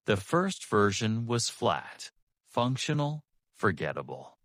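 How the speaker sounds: ordinary talk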